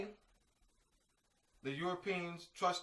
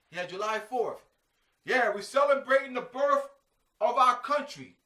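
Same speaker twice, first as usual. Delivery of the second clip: higher, louder mock celebration of July fourth; sarcastic flag-waving patriot, pitched up and loud; mock patriotic cheer; 9 semitones higher, 8 dB louder than usual. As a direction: ham up a proud patriot cheering the birth of the nation.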